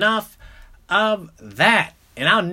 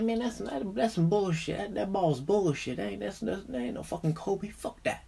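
mumbled, much softer scoffing cousin; hater cousin; 10 dB quieter than usual. Retold or imitated. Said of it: "he was a hater".

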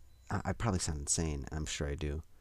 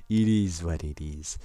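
slower, louder mock shrug; exaggerated letdown; 10 dB louder, slower than usual. Podcaster hamming up his own letdown, slower and louder. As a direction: drag it out as a bigger deadpan shrug. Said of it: "be like".

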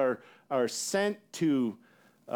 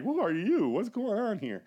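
imitating alarm, voice pitched up; mock freak-out; 4 semitones higher than usual. Daniel trembling before the angel, mock freaking out. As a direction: play mildly panicked questions. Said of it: "He's freaking out".

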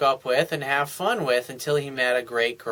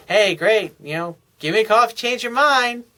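breezy brush-off; 7 semitones higher, 4 dB louder than usual. Retold or imitated. Voicing the woman brushing him off.

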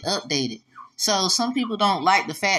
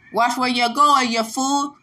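mock scolding; 5 semitones higher than usual. Telling off someone who bumped him, mock scolding. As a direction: scold a clumsy passer-by. Acted scolding of careless walker.

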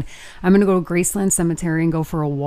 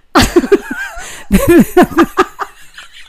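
loud, high burst of laughter; real laughing fit; 14 semitones higher, 7 dB louder than usual. Real, not acted. Host cracking up over cousin Vinny, high loud laughter. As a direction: crack up long and helplessly. Cracks up at her own remark.

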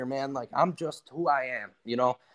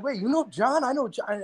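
higher, amazed disbelief; surprised; 10 semitones higher than usual. Retold or imitated. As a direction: retell the small-world shock lightly. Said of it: "And Adam's like".

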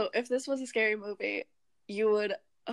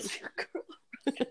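breathy slow creepy whisper; whispered creepy echo; 4 semitones higher, 8 dB quieter, slower than usual. Retold or imitated. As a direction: hiss it like a stalker. Echoing Ghostface, whispered.

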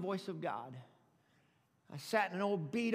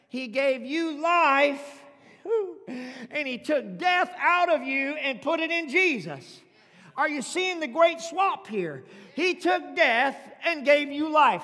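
loud, slow, ringing preacher's call; full-force preaching; 6 semitones higher, 10 dB louder, slower than usual. Acted. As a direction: preach it out to the back row.

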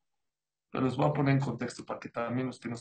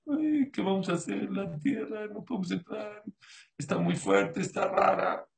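mock whining; 6 semitones higher than usual. Imitating the spies' whining.

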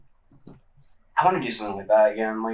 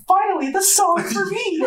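very high, drawn-out squeal of delight; hammed-up fan excitement, breaking into laughter; mock elation; slower than usual. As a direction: gush in a sky-high, stretched-out voice. Retelling own delight at the song.

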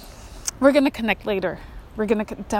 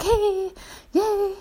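high bright yay; mock cheer; 10 semitones higher than usual. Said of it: "I was like".

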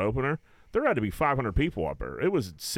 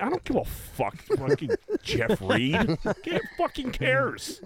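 higher, dragged-out scoff; higher, slowing mock disdain; 12 semitones higher, slower than usual. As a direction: stretch it out like you couldn't care less. Retold dismissal of celebrity.

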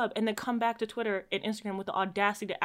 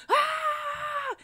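high cartoon scream; SpongeBob scream. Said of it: "That's what he just sounded like".

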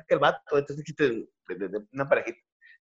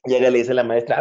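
normal talking voice

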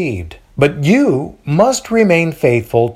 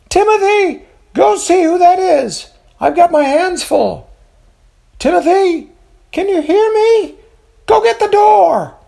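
high shrill yelling mother; loud sharp voice; 4 dB louder than usual. A narrator's reading. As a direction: call out shrilly from behind a door. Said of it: "A shrill voice yells out".

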